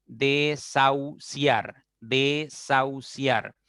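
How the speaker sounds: "desahuciar" slowly as de, sau, ciar; slow syllable demo. Shown as a demonstration. Teacher gives students another silent H example, slow syllable by syllable. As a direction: separate syllables of "desahuciar", AU held together. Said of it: "Lo mismo pasa con".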